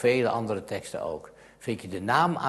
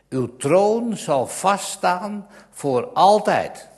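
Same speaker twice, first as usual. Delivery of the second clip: raised, solemn reading that slows right down at the end; solemn Bible reading; 6 semitones higher, 7 dB louder, slower than usual. Acted. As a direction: proclaim the promise from the pulpit. (plechtig, verheven voorlezen)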